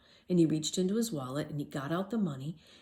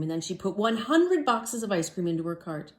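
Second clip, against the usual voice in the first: ordinary delivery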